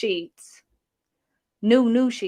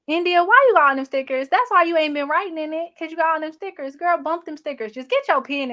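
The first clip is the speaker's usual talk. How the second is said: playful scolding; 7 semitones higher, 3 dB louder than usual. Real, not acted. Scolding commenter India about stickers.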